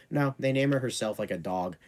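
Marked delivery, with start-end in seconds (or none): none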